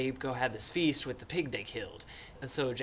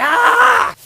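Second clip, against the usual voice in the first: very high, loud shriek; hammed-up falling scream; imitated death scream; 20 dB louder than usual.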